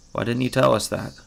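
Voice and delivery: normal talking voice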